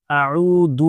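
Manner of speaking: اعوذ with a full throaty ayn at the start; ayn pronounced clearly. Shown as a demonstration. Teacher demonstrating اعوذ, clear throaty ayn. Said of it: "آئین کو بھی برابر سے نکالنا ہے".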